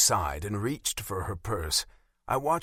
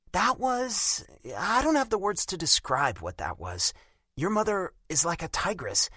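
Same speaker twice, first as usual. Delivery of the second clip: put-on higher, hurt young woman's voice; a wounded girlfriend, raised in pitch; Bella's voice; 8 semitones higher than usual. A narrator's reading.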